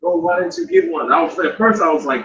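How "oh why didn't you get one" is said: high, slow imitated daughter's voice; mock teasing daughter. Daughter tempting fasting father, high mock teasing voice. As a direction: tease like a kid showing off food. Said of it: "she kept saying".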